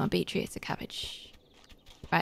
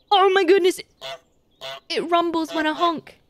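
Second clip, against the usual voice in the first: delighted surprise; 7 semitones higher than usual. Real, not acted. The gamer discovers the honk rumble, delighted surprise. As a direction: gasp it out, delighted.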